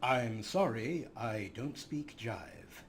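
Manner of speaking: deadpan. Real, not acted. Mocking the wizard's spell language.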